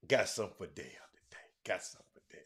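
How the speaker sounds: very low, near-whispered aside; hushed, low mumble; muttering to self. Real, not acted. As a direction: murmur it softly to yourself. To self while cueing a song.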